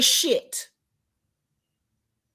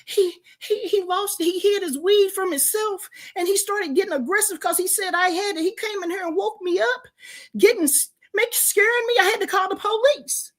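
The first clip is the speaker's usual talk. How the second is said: high, halting mock-frightened voice; imitated scared caller; 7 semitones higher than usual. Caller telling a friend about an aggressive partner, scared. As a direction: stammer it out, pitched up, as a retold plea.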